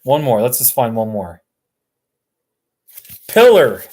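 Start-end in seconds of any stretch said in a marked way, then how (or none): 3.3-3.8 loud, higher Arkansas drawl on Pillar, said like pillow; mock Arkansas pronunciation of Pillar; Arkansas accent. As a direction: read Pillar as a proud Arkansas local would. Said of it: "That's how they talk in Arkansas".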